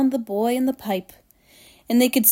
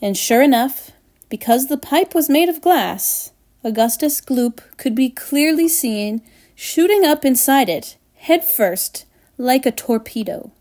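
ordinary delivery

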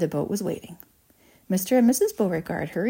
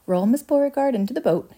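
higher, projected order; brisk command; 6 semitones higher, 4 dB louder than usual. A narrator's reading. Wonka orders Oompa Loompas to move Violet, projected command. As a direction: call out the instruction.

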